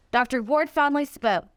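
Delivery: usual speaking voice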